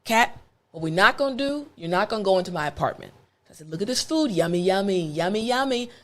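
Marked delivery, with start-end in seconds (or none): 0.0-3.1 quiet, firm midnight warning to the cat; softly stern late-night talk to a cat; hushed warning. Retold or imitated. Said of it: "Remember, it's after midnight". 3.7-5.9 soft coaxing yummy yummy; hushed sweet-talk to a stray cat; coaxing whisper-soft. Retold or imitated. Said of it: "I'm trying to be quiet".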